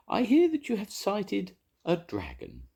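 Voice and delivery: high put-on grand lady; posh lady. A narrator's reading. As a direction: ask it like a grand society lady. Posh investigator voiced.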